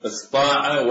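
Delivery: "استطاع" shortened to istaa'a, plain ت; light ت form. Shown as a demonstration. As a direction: say "استطاع" as istaa'a without a heavy ط. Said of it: "نقلب الطاء إلى تاء". (نطق "استطاع" استاع بتاء مرققة)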